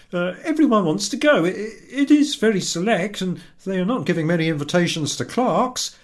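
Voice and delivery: pleading with his wife to be glad; husband's voice. Acted.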